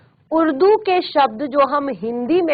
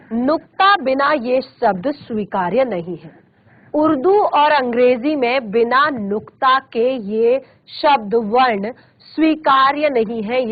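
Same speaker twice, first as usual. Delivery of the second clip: ordinary talk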